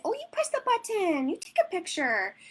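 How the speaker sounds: put-on high, bright instruction to a toddler; sing-song coaching. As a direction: make the button sound like a treat. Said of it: "I sound ridiculous when I'm doing sessions".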